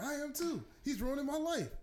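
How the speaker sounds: lightly put-on complaining cartoon voice; lightly voiced rival. Retelling South Park, the rival confessing to Jesus in a light put-on voice. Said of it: "He's like".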